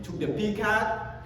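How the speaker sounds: usual speaking voice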